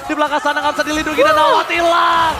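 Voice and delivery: shouted hype play-by-play; fighting game announcer. Acted. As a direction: scream the teamfight call. Live play-by-play at full hype.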